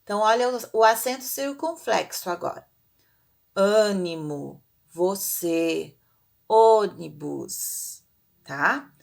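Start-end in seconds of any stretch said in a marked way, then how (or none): 3.5-8.0 "Ânimo, você, ônibus" low and drawn out with closed vowels; slow, low-pitched word list with closed stressed vowels; closed circumflex vowels. Shown as a demonstration. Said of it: "mais fechado".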